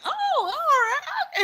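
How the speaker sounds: very high, slow sing-out; front desk lady. Retold or imitated. Receptionist accepting Latin American Spanish, high and drawn out. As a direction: stretch it high like a cheery clerk. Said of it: "She's like".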